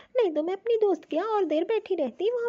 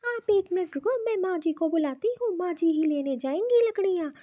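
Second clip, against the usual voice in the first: ordinary talk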